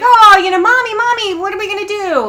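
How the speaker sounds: put-on high, loud worried children; panicked kids. Kids in the car fretting over the dog, high and loud. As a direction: play the alarmed little kids. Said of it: "the kids were like".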